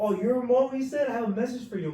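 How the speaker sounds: high, loud yelled call; inmate calling out. Retold or imitated. Inmate baiting speaker, loud high call. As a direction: hail him with fake friendliness.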